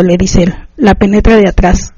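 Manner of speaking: plain reading voice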